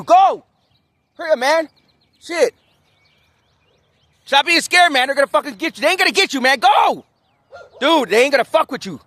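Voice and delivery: high-pitched urging of a scared dog; high coaxing voice. Real, not acted. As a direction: egg the nervous dog on.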